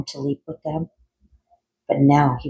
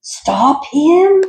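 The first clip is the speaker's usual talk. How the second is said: even higher, shrieking, louder repeat; frantic shout; 13 semitones higher, 6 dB louder than usual. Acted. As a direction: scream it in full panic. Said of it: "Doctor Franken shouted".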